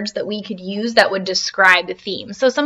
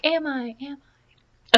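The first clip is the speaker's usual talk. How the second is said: soft, far-off echo; fading echo; 5 semitones higher, 6 dB quieter than usual. Retold or imitated. The canyon echoes Slim's call back, soft and distant. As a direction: let the words fade away like an echo.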